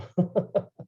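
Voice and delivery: amused little laugh to oneself; chuckle. Real, not acted. Chuckles at own story.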